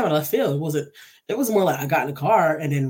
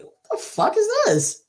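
high-pitched startled reaction; retold shocked disbelief; 14 semitones higher, 3 dB louder than usual. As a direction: act out an unwelcome surprise. Retold reaction to woman in car.

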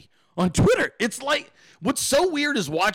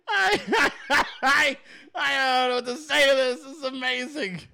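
higher, breaking into laughter; laughing disbelief; 5 semitones higher than usual. Real, not acted. Reacting to the clip, laughing in disbelief. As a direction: laugh through the words, lost for them.

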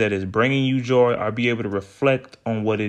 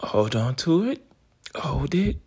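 guided meditation; slower than usual. Acted. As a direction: ease them into calm breathing, slowly. Leads a breathing exercise.

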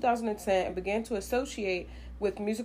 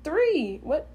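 surprised; 8 semitones higher, 5 dB louder than usual. Real, not acted. Correcting the age gap.

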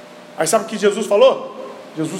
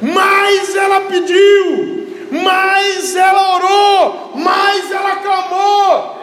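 high, loud, very slow shouted lines; preaching climax; 11 semitones higher, 6 dB louder, much slower than usual. Acted. Pastor to congregation about the woman's persistence, booming. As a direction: hammer each phrase at the crowd.

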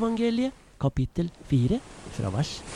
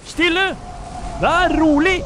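high, loud command; commanding shout; 13 semitones higher, 7 dB louder than usual. Acted. Jesus commanding the wind and waves, loud firm shout. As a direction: order the wind to be still.

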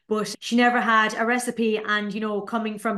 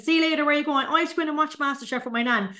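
high, eager shout-out to friends while running off; like a child dashing home for telly; excited kid calling out; 6 semitones higher, faster than usual. Retold or imitated. Child calling to friends while running home, high and eager.

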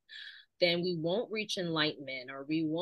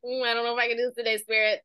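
fast, anxious rush, a bit higher; imitating a frantic inner voice racing through worries; 5 semitones higher, 4 dB louder, much faster than usual. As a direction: rattle it off like a panicky inner voice. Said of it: "The frantic one that's like".

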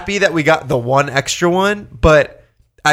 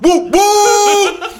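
excited cheering; 3 dB louder than usual. Real, not acted. Cheering the waitress over toast.

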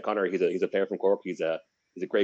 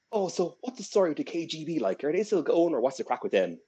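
cheeky Irish lad; 9 semitones higher than usual. Retold or imitated. Connor voiced, asking Russians cheekily.

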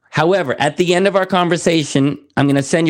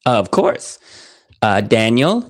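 normal talking voice

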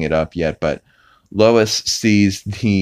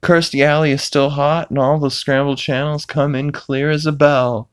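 Peter impression; 6 semitones higher than usual. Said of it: "Peter's like".

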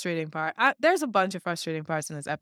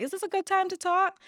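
timid high ask; 11 semitones higher than usual. Retold or imitated. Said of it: "she's like".

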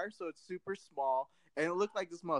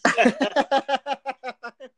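high, loud burst of laughter; real loud laugh; 9 semitones higher, 9 dB louder than usual. Real, not acted. Cracking up at co-host's podcast joke, loud real laughter. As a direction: crack up loudly.

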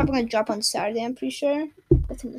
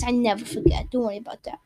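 ordinary talk, nothing marked

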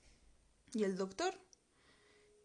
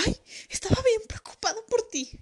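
much higher, louder fussing mother; worried mom; 13 semitones higher, 6 dB louder than usual. Retold or imitated. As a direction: gush like an anxious mom. Mom voiced in story. (la mamá preocupada, aguda)

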